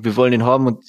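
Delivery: ordinary delivery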